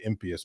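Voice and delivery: impious as IM-pee-us; long-e pronunciation. Retold or imitated. Mimicking insistent sticklers, impious said IM-pee-us. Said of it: "people really wanna say".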